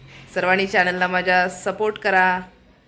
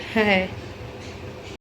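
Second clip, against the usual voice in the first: short airy, voiceless laugh; soft chuckle; 6 dB quieter than usual. Real, not acted. Vlogger facing more chores, soft chuckle. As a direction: chuckle softly to close the thought.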